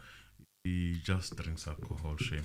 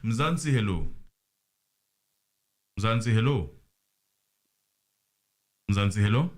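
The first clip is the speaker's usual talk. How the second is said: loud call into the phone line; 3 semitones higher, 9 dB louder than usual. Real, not acted. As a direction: call out to the caller on the line, louder each time. Host calling out to caller.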